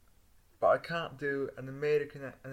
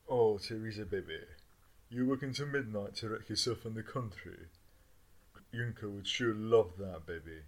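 seductive voice; 6 semitones lower, 4 dB quieter than usual. Acted. Crooning lover voice.

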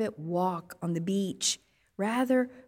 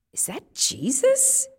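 unsure wonder; 5 semitones higher than usual. Acted. Disciples recognising risen Jesus.

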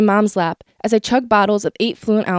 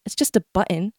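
dismissive; 3 semitones lower, 7 dB quieter than usual. Retold or imitated. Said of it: "she says".

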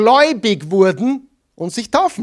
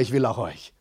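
quiet, mumbled, half-hearted; said without any conviction; unenthusiastic; 7 semitones lower, 9 dB quieter than usual. Real, not acted. Congregation repeats it back, half-hearted and quiet. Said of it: "Das war nicht sehr begeistert".

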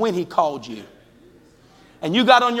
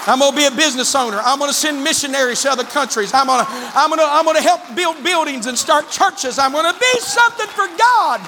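building preach; 6 semitones higher than usual. Acted. Preacher voicing the church member's vow to the congregation, building to a cry. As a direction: build each promise until it bursts out.

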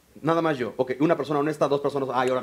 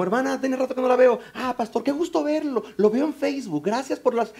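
higher-pitched mock-delighted churchgoer; gushing greeting; 6 semitones higher than usual. Church member running into pastor at the market, gushing. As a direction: greet the pastor with exaggerated pleasure, high and light.

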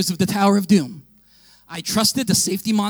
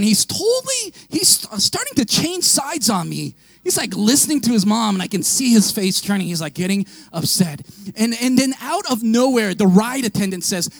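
ordinary delivery

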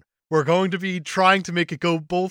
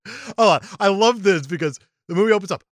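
normal talking voice

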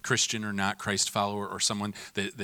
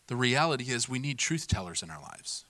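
ordinary talk, nothing marked

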